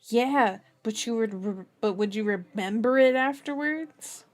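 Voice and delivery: lightly mocking tease, rising to the question. A narrator's reading. Joseph teasing older Barry about his memory, mocking. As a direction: needle him playfully, punching the end.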